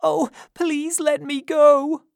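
put-on very high, loud child's plea; frightened high wail; crying out in fear. A narrator's reading. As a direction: beg desperately in a small boy's voice. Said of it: "cried Oliver".

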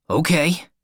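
hopeful. A narrator's reading. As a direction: grab at the good news. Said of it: "Carlo replied hopefully".

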